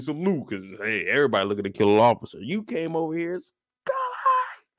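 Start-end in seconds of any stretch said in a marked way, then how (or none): 3.4-4.7 very high drawn-out mock cry; woman wailing a name. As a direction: wail the name up at the windows. Woman calling out.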